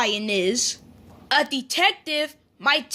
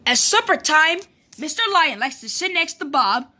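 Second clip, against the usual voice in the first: ordinary delivery, nothing marked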